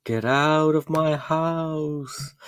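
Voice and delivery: slow, drawn-out spooky ghost. Pretend. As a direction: moan it like an unwelcoming ghost. Ghost voice after haunted house.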